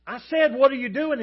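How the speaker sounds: very loud, high exasperated repeat; frustrated hollering. Acted. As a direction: holler it back in acted frustration. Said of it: "finally get frustrated".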